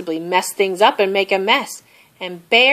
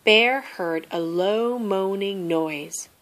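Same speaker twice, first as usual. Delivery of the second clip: ordinary delivery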